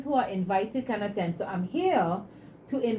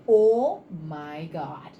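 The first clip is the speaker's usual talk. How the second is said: stunned awe; 6 semitones lower, much slower than usual. Retold or imitated. Client stunned after walking test.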